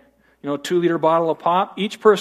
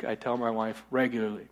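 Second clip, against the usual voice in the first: usual speaking voice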